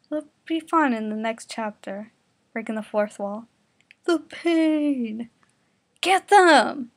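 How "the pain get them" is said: high, loud scream. Acted. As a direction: shriek the order to attack. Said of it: "Kuroko screamed".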